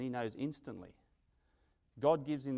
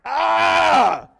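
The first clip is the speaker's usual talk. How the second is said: very loud, high cry of frustration; loud, high frustrated yell; 20 dB louder than usual. Acted. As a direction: let it all out in one loud shout. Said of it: "you just wanna go".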